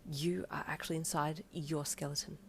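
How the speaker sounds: soft spooky whisper; low whisper. A narrator's reading. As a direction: breathe it low and eerie. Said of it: "you said lowly".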